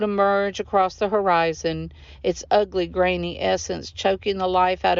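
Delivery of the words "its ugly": ordinary delivery, nothing marked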